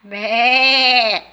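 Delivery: loud mock sheep bleat; playful baa for a children's class. Animal sound for kids.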